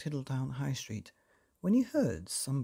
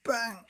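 higher, punchy bang; 8 semitones higher than usual. A narrator's reading. Hammer hitting nose, punchy bang. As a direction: hit it like a hammer. Sound word in story.

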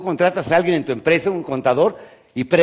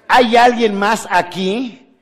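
slow, higher, louder calling out; mock distrustful call; 8 semitones higher, 7 dB louder, much slower than usual. Speaker replays the joke's line to the audience, slow and loud. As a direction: drag it out, asking for someone else. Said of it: "¿Qui-, quién dijo?".

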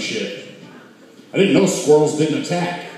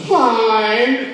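mock serious hi; 11 semitones higher than usual. Shown as a demonstration. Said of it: "look at 'em all serious and go".

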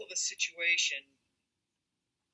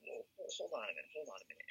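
defuse it quietly, without any push; calm monotone; 11 dB quieter, much faster than usual. Shown as a demonstration. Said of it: "talk in a very, uh, monotone way".

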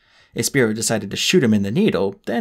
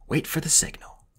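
whispered; 5 semitones higher, 6 dB quieter than usual. Acted. Holly's inner thought.

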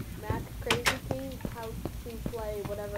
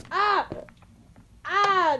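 high, loud shrieks; frightened screams; 10 semitones higher, 13 dB louder than usual. Real, not acted. Monster scare in game.

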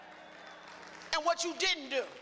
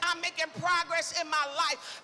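bold, driving preacher's chant; bold declaration; 3 semitones higher than usual. Shown as a demonstration. Preacher leading the congregation in confessing progress, bold. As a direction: proclaim each line boldly, building the list. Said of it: "Say it like you bold".

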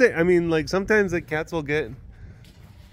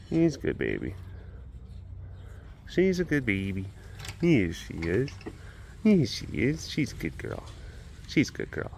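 tender cooing; 6 dB quieter than usual. Real, not acted. Praising the tiger in a soft tender coo. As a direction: gently praise the big cat.

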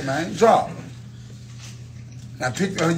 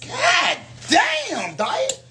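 exasperated yell; 13 semitones higher, much slower than usual. Real, not acted. Reacting to a bad roll.

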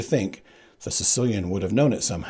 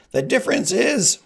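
very high, louder; Sicilian's voice; 13 semitones higher, 6 dB louder than usual. Acted. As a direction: cut in high. Sicilian answering.

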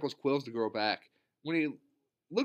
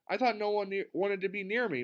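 usual speaking voice

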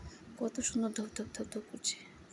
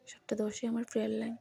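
usual speaking voice

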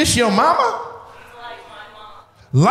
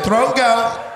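mock audience member; 3 semitones higher than usual.

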